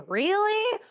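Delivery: leaps high on Really, sharp rising question; a squeaky high Really in disbelief; incredulous really. Retold or imitated. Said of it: "And I was like".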